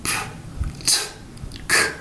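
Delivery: "P", "t", "k" with a clear puff of breath; breathy aspirated stops. Shown as a demonstration. Teacher demonstrating the puff of breath. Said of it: "you hear a little puff of breath".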